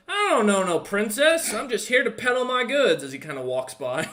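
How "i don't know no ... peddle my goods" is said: higher, louder brush-off from a busy merchant; dismissive merchant. Acted. Merchant brushing off the princess question, higher and louder. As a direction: wave them off and keep walking. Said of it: "Merchant looks at you and goes".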